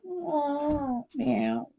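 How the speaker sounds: high, soft, sleepy cat mews; drowsy high kitty meows; waking cat meows. Acted. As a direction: mew like a cat just waking.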